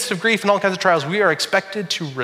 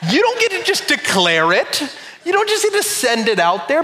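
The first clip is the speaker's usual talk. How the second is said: high, playful accusing tone; mock accusation; 10 semitones higher than usual. Pastor teasing his wife about Purse Day, high mock accusation. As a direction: pin the blame on her, jokingly. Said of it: "look at my wife and think".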